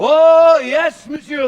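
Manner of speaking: loud high cry to hold him; loud plea. Acted. Stopping the rival from leaving, a loud cry. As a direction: call him back in alarm.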